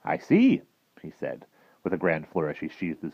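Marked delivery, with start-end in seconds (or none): 0.0-0.6 admiral voice. Acted. Admiral speaks.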